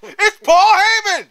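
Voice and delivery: high, very loud, drawn-out yell; mock shocked shout. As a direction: holler it in mock disbelief. Mock wrestling announcer outburst.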